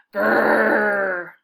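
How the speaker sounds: sudden shrill scream of fright; high scream. Acted. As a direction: shriek as the troll leaps out. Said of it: "his sister's high-pitched scream".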